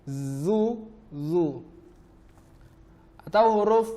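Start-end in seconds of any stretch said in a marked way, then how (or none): 0.1-1.4 a light buzzing "Zu", then a heavy emphatic "zu"; thin "Zu" first, thick "zu" after; zai versus zo. Shown as a demonstration. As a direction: drill contrasting zai and zo. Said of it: "zai dibaca zu, sedangkan huruf zo dibaca zu".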